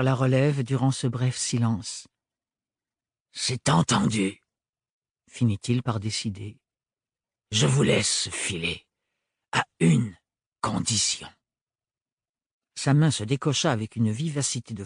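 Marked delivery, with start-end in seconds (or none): 3.3-4.4 menacing sans-peur. Acted. Sans-peur decides coolly. 7.5-11.3 menacing sans-peur. Acted. Sans-peur sets his condition.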